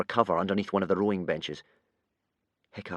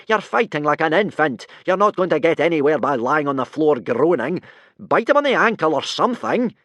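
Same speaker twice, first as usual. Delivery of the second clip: exasperated yell; 7 semitones higher, 7 dB louder than usual. A narrator's reading. Teacher yelling at his struggling pupil, exasperated. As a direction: yell in frustration. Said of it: "yelled Gobber in exasperation".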